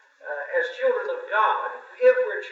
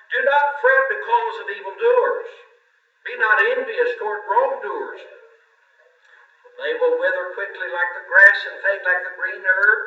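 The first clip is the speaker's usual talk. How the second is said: scripture reading; 2 semitones higher, 5 dB louder than usual. Acted. Reads Psalm 37 aloud.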